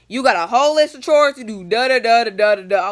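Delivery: loud, rattled-off mom orders; imitating bossy mom. Mom assigns chores before leaving, loud bossy imitation. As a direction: lay down orders loudly as mom.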